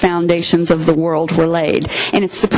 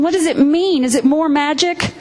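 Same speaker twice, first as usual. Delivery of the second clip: put-on higher, urgent child's question; distressed cry; 8 semitones higher than usual. A narrator's reading. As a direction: call it out high in alarm. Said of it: "cried Susan".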